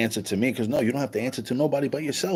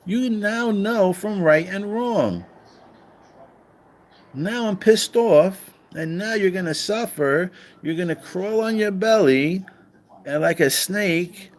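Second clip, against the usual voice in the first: mock angry God, pitched higher; an irate God cursing the snake, pitched up; pissed off God; 7 semitones higher than usual. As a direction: ham up God losing control, raising his voice. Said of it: "he's like".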